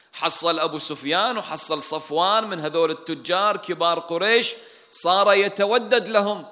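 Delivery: loud, high-pitched, drawn-out protest; indignant grievance. Retold or imitated. Ansar resenting Quraysh's big men, indignant protest. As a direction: pile up the resentful examples loudly. الأنصار يحتجون على عطاء كبار قريش.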